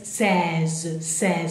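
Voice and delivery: "seize" drawn out, open E and buzzing Z; slightly exaggerated pronunciation. Shown as a demonstration. Teacher helping learners practise sixteen, exaggerated sounds. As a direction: stretch "seize" for learners to copy. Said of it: "Se lo estoy exagerando un poco".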